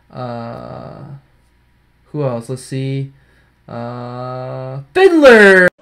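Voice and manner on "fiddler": excited. Real, not acted. Pack pull reveal.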